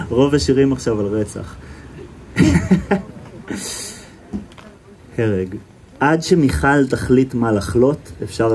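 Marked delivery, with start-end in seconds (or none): 2.3-3.0 short high giggle breaking out; high burst of laughter. Real, not acted. The reader laughs at his own line about murder songs, high giggle. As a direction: laugh despite yourself.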